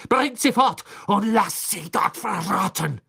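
loud, high, furious order; thundered. A narrator's reading. Crouch orders Dementors to take the prisoners away, thundering. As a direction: thunder it with spitting rage. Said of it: "donnerte Crouch den Dementoren entgegen".

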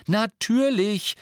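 high, loud, drawn-out cry of realisation; sudden loud realisation, stretched out; exclaiming. A narrator's reading. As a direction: cry out as it suddenly dawns on you. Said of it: "rief Hermine".

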